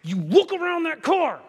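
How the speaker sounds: sharp high yell at a daughter; retold scream. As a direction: bark it out as a warning. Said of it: "I have had to scream at her before".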